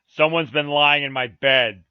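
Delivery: loud, slow huge bear; great rough voice. A narrator's reading. Huge bear finds his bed disturbed, loud rough voice. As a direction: boom it out slowly as a big rough bear. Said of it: "in his great rough voice".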